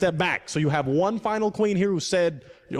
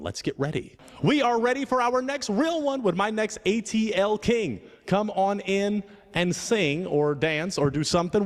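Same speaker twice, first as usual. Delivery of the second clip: slow, drawn-out, higher-pitched introduction; show host announcer; 5 semitones higher, slower than usual. Acted. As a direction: usher in the next contestant with stretched-out fanfare. Host announces next contestant.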